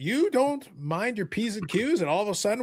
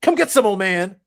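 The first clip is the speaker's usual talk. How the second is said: taunting; 7 dB louder than usual. Retold or imitated. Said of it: "Eric's like".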